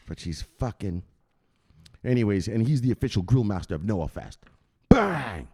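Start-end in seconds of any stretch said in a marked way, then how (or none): 4.9-5.5 bang. Real, not acted. Single bang yell.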